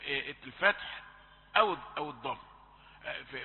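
normal talking voice

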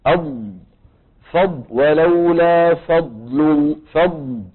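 the letter ض in فض drawn out slowly; slow-motion فض showing the tongue; فض said slowly, ض stretched; slowed ض demo. Shown as a demonstration. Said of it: "طبعًا الآن أنا عم بطئ".

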